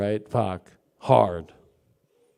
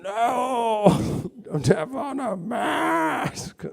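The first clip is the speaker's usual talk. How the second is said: high cry-shout refusal, laughing through it; a high, slow, mock-crying no; mock tearful refusal; 9 semitones higher, much slower than usual. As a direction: wail a refusal, half-laughing. Refusal in acted quarrel.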